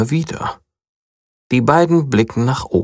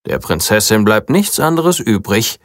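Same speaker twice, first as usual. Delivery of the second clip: ordinary delivery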